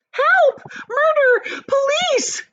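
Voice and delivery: high, loud panicked screams; screaming for help. A narrator's reading. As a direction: scream it like she's being robbed. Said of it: "screamed Missus Gloop".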